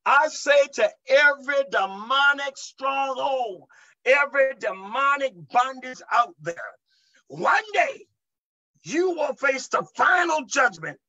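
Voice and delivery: high, ringing pulpit declaration; forceful preaching. Real, not acted. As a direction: declare judgment to every demon in full preaching voice. Preaching at demonic strongholds.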